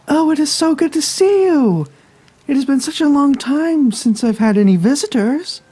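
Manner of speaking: high, gushing welcome; delighted caregiver. Acted. Caregiver greets her visitor in a put-on voice.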